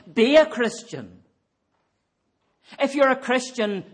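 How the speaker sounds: rally the congregation to live their faith; full-force preaching. Acted.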